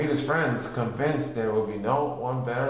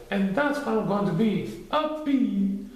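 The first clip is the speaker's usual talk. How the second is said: high curious Pooh voice; a perked-up little bear; 8 semitones higher than usual. A narrator's reading. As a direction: Pooh with sudden interest. Pooh's voice.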